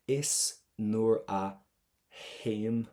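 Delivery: "haim" begins on a breathy H, no T; slow, separated words, "haim" with a silent T; no T sound. Shown as a demonstration. Teacher models the line for exam students, softened T in "haim". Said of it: "I should not hear that T sound".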